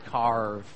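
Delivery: low mocking "carve" with a heavy R; American "carve". Shown as a demonstration. Brit mocking his American audience, R-heavy "carve". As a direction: say "carve" deep, leaning on the "arr". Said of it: "you're all strange".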